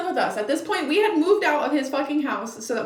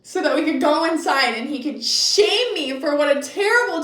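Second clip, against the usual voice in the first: ordinary talk, nothing marked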